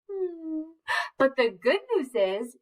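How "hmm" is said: high, soft pretend whimper; mock whimper. Shown as a demonstration. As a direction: show them a fake sad little cry. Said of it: "Make your sad face".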